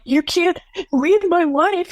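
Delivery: high, strained, tearful plea; imitating hysterical crying. Son begging grandfather on speakerphone, high tearful pleading. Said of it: "he was just hysterical".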